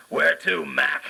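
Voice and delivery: tossing off a cabbie's line; cab driver. Retold or imitated. The cab driver greets his famous passenger, gruff cabbie voice. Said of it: "And he said".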